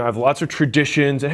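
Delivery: usual speaking voice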